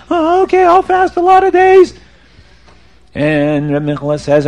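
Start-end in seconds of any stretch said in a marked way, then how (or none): 0.1-1.9 high, loud mock wail; crying penitent. Sinner pleads with the rabbi, hammed-up crying. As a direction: wail like a desperate, guilt-ridden man. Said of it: "the man is crying and screaming".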